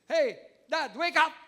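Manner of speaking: put-on a kid hollering at sleeping dad; child yelling wake up. Child shouting dad awake.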